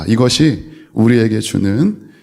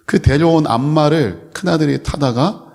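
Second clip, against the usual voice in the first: usual speaking voice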